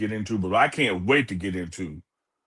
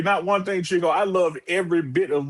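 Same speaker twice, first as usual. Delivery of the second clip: much higher, emphatic; 8 semitones higher than usual. Real, not acted. Host telling co-host he agrees fully, emphatic and high. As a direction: insist there's nothing to argue.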